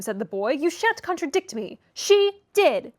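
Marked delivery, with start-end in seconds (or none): 0.5-1.8 high, petulant insistence; petulant boy. A narrator's reading. Children quarrelling. 1.9-2.9 high, loud outcry; crying out. A narrator's reading. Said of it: "cried my young lady".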